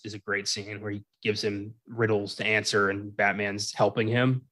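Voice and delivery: ordinary talk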